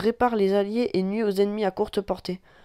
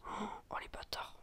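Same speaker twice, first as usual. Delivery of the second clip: very soft unvoiced whisper; whispered curse; 21 dB quieter, slower than usual. Real, not acted. Whispering curses at campers. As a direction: breathe the insult out. Enemies camping the flag.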